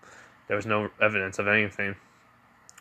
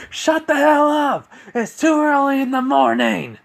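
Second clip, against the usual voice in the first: yelling; 9 dB louder than usual. Acted. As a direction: yell it as if covering your ears against the noise. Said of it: "You never yelled at us".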